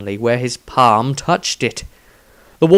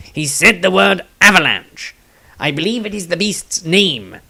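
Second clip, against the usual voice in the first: a hint of halfling voice, higher; lightly voiced halfling; 4 semitones higher than usual. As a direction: explain it lightly in his voice.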